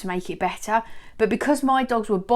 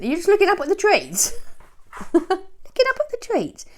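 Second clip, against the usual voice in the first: high cooing singsong; 10 semitones higher than usual. Real, not acted. Owner coos at Albert gazing at the trees, high baby talk.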